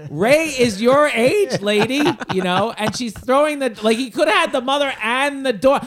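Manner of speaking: loud, high-pitched exasperated outburst; incredulous rant. Acted. Ranting at a matchmaking mother.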